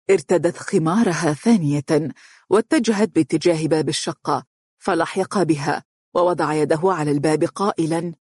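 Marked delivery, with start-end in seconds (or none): none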